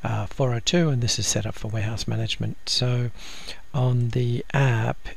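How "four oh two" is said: normal talking voice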